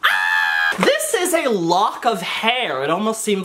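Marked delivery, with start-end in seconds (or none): 0.1-0.8 mock scream. Mom reacts to bag on head.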